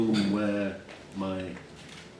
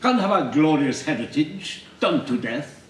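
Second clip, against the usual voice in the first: high, loud posh indignation; nicely spoken teacher; 5 semitones higher, 4 dB louder than usual. Acted. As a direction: protest as the haughty English teacher. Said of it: "He was nicely spoken".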